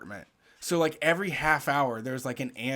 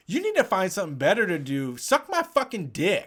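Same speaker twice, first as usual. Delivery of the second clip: imitated yelling; 4 semitones higher, 4 dB louder than usual. Passing lady telling off screaming protester, retold yell. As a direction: act out a woman telling off a man. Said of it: "that woman coming and yelling at him".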